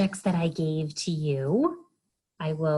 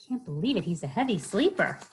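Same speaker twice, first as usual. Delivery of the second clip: ordinary delivery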